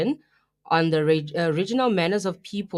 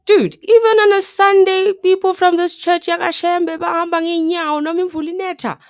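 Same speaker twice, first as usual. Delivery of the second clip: loud, high-pitched disbelief; incredulous outburst; 9 semitones higher, 9 dB louder than usual. Retold or imitated. Host retelling own reaction to barefoot churchgoers, in a loud incredulous voice. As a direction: exclaim it loud and high, scandalised.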